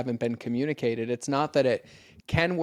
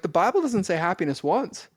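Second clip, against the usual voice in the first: joking quip; 7 semitones higher, 5 dB louder than usual. Retold or imitated. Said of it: "I joke with people".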